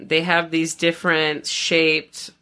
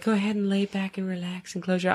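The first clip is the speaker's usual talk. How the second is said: soothing instructor voice; 3 semitones higher, 7 dB quieter than usual. Retold or imitated. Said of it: "they were like".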